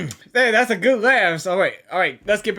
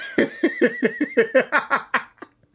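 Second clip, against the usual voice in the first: laughing; 4 semitones higher, 3 dB quieter than usual. Real, not acted. Laughing at the nicknames.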